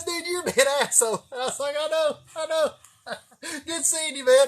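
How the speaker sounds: high, laughing voice. Retold or imitated. Friends trade jokes at the stop, laughing. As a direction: banter while cracking up.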